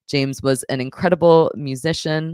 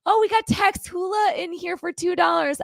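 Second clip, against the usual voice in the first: excited shout. Real, not acted. Donation reading, sudden outburst.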